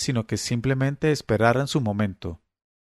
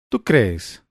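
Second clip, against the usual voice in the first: ironic, defiant; 2 semitones lower than usual. Acted. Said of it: "Su tonito irónico, desafiante".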